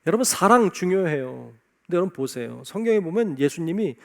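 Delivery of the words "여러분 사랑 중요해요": usual speaking voice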